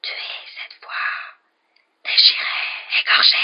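a hissing, menacing whisper; a ghostly voice thirsting for blood; a sinister whisper rising in force; eerie disembodied voice. Acted. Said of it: "il entendit à nouveau la voix".

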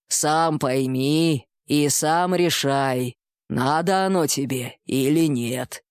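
low, slow, weighty bull-man; slow minotaur voice. Acted. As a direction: drawl each word like a wise minotaur. Minotaur gives final advice.

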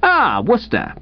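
high, drawn-out "Ah" greeting, condescending; patronizing. Acted. Invalid rival greeting Wooster from his sickbed, patronizing. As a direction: greet him with lofty condescension. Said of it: "a dashed patronizing manner".

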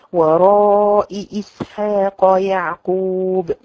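very slow chanted جاء أمرنا with the hamzas held; تلاوة مجودة بطيئة; Quran recitation. Shown as a demonstration. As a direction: recite ورائي drawn out in tajweed style. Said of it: "الهمزات متتالية".

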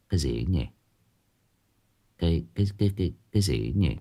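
low, hushed, stammering puzzlement; uneasy muttering. Acted. Uncle Hà puzzled by slippers inside, hushed and uneasy. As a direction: mutter it softly, tripping on the first word. Hears slippers in empty house.

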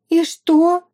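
high, loud, incredulous question; sharp leap up in pitch and volume; astonished. A narrator's reading. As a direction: demand it with sudden disbelief. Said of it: "выпучила глаза Эрика".

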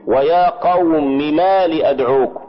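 ordinary delivery

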